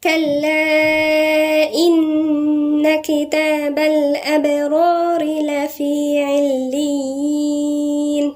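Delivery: slow, melodic tajweed chant; measured Quranic recitation. Acted. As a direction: recite the verse slowly, drawing out the vowels. Recites the verse.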